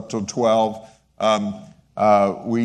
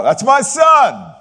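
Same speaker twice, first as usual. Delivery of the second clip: high, loud, drawn-out cheer; proud shout from the stands; 8 dB louder, slower than usual. Retold or imitated. Father cheering his athlete son from the stands, loud and proud. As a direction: yell it out like a proud dad at a game. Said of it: "they would hear me cry out".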